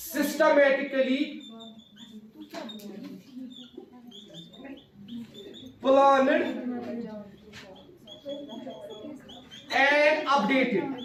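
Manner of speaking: very slow, one word at a time with long pauses; teacher spelling out a definition for students to write down; slow dictation. Shown as a demonstration. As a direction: dictate it word by word for note-taking.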